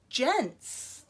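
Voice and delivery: ordinary delivery, nothing marked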